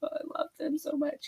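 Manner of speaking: high, soft gushing; a hint of a giddy fan. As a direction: swoon over a favorite book. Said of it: "so I'm like".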